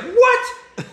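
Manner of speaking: very high, loud reenacted disbelief; incredulous shriek. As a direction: squawk it in mock outrage. Reenacts disbelief at wrong answer.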